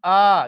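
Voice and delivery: आ as a drawn-out 'aa'; long aa sound. Shown as a demonstration. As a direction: hold आ long and open. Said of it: "अलिफ मद ज़बर आ".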